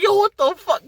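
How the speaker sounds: high and loud shocked cheer; hyped yell. Retold or imitated. Fan watching Cap wield Mjolnir, hyped high yell. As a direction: blurt it out like the crowd just went wild.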